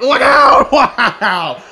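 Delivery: very loud, high yell breaking into a laugh; thrilled commentator outburst; excited shout. Real, not acted. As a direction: holler a warning, then laugh in amazement. Shouting at a big throw.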